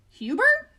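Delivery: high, loud guessing question; suggesting. Acted. Fluffy guessing the rhinoceros's name, high and loud. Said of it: "suggested Fluffy".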